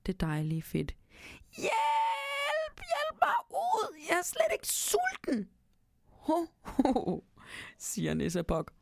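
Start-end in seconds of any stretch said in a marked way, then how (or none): 1.6-5.5 very high, crying cries for help; trapped mouse wailing to get out; panicked pleading. A narrator's reading. 6.1-7.2 high ho ho ho; mocking laugh. A narrator's reading. Nisse laughing at mouse.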